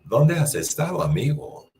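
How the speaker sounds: Dónde starts high and falls; rising inflection. Shown as a demonstration. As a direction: lift Dónde high, let amigo drop. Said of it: "voice inflection".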